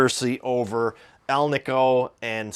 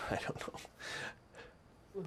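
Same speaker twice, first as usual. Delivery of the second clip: slow, trailing whisper; 9 semitones higher, 21 dB quieter, much slower than usual. Real, not acted. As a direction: whisper it slowly, fading out. Whispered aside after a laugh.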